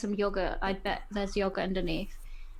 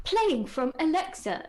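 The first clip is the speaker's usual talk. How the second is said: higher-pitched mock smart speaker announcement; pitched-up device voice; Alexa announcement; 7 semitones higher, 3 dB louder than usual. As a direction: imitate a voice assistant butting in. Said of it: "it'll probably be, like".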